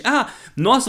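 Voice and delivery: ordinary talk, nothing marked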